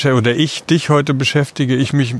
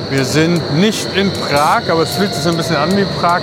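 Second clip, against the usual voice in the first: atmospheric narration; 4 semitones higher than usual. Acted. Poetic scene-setting intro.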